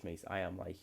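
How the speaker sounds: normal talking voice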